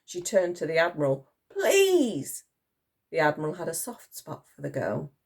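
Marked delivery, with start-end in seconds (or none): 1.5-2.4 high, drawn-out please; begging. A narrator's reading. Girl turning to the Admiral, begging. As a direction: plead in a high voice.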